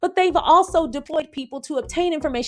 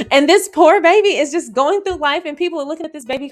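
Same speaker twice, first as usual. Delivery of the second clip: worked-up outrage; 6 semitones higher, 6 dB louder than usual. Real, not acted. Outrage over the mocked baby.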